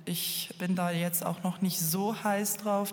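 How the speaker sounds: normal talking voice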